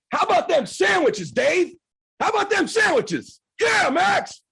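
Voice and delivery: high, loud gloating cheer; triumphant shout. Retold or imitated. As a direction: crow it at the doubter. Gloating celebration after win.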